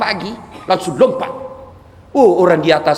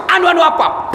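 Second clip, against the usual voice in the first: angry shout; 12 semitones higher than usual. Acted. Angel losing patience with the boss, angry shout. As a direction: yell it at him, fed up.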